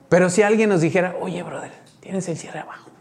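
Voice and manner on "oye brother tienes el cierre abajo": whispered aside, softer, no voice; discreet whisper. Retold or imitated. Preacher voicing a friend warning about the open zipper, whispering. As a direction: lean in and tell him quietly so nobody hears.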